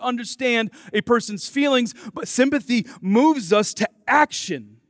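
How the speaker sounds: loud, rising pulpit preaching; preaching at full force. Acted. Pastor preaching to the church, loud and urgent. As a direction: drive the gospel home to the congregation.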